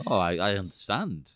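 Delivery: ordinary delivery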